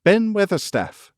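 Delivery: high, loud call upward; girl calling up to an angry gardener. A narrator's reading. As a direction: call up to the wall. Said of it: "called out Mary".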